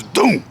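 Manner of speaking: short hollow "doom"; imitated thud. Ball thrown into a bucket, imitated thud. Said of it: "it goes".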